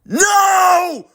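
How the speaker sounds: high raw scream of no; anguished shout. Acted. Seeing Frank destroyed, screaming no. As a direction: cry out as the friend falls. Frank dies before his friends.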